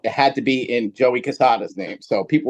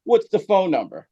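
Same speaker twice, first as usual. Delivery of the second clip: higher, mock-sarcastic "sure it is"; sarcastic disbelief; 7 semitones higher than usual. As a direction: play along like you know it's a prank. Thinks friends are pranking him.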